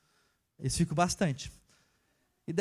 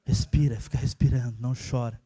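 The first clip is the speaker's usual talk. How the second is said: much lower, gentle words to a newborn; tender murmur to baby; 9 semitones lower than usual. Retold or imitated. Father watches his newborn daughter at night, tender murmur.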